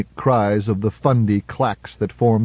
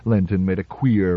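usual speaking voice

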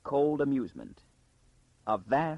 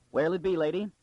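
taxi man; 6 semitones higher, 3 dB louder, faster than usual. A narrator's reading. Taxi man greeting a passenger.

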